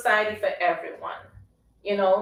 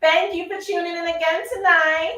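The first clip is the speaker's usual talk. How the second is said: excited greeting; 9 semitones higher, 8 dB louder than usual. Real, not acted. Greeting a live viewer.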